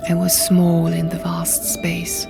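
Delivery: plain reading voice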